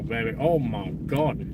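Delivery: ordinary delivery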